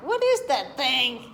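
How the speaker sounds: slow, lightly voiced puppy; a hint of a puzzled dog voice; puppy's thoughts. Owner voicing the puppy's thoughts on the cat, lightly puzzled. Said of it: "He's like".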